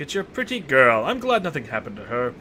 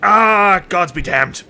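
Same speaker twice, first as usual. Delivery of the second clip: loud exasperated curse; 4 semitones higher, 8 dB louder, much slower than usual. Real, not acted. Giving in to a hard decision.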